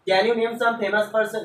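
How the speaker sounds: slow, deliberate English for the class; slow read-aloud. Shown as a demonstration. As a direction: read it out slowly, word by word. Teacher reads English text slowly.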